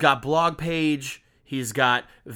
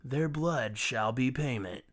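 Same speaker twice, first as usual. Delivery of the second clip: dramatic reading; 3 semitones higher, 5 dB louder, slower than usual. Acted. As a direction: pronounce doom like a melodrama villain. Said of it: "as dramatically as I possibly can".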